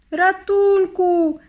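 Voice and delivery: high, loud, drawn-out scream; cry for help. A narrator's reading. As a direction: scream for rescue as the spell falls. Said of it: "krzyknęła Sawa".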